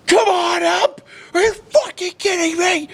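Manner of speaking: very high, loud outraged yell; dad losing it. Retold or imitated. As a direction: imitate an irate dad screaming at the ump. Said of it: "my dad loses his mind".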